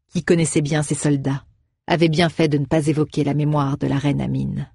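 usual speaking voice

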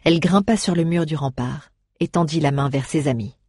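ordinary delivery, nothing marked

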